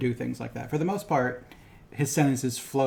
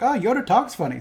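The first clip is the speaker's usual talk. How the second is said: mock producers; 10 semitones higher, 7 dB louder than usual. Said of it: "they're like".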